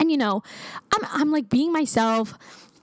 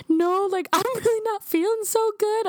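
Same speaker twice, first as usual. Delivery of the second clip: higher, on the edge of tears; teary; 10 semitones higher than usual. Retold or imitated. Telling worried coworkers she feels sick, teary and higher. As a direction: let the voice climb as tears come. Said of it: "I kinda got teary-eyed".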